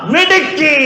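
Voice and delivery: high, exclaimed praise; delighted praise. Real, not acted. Teacher praises a pupil for the right answer, delighted exclamation.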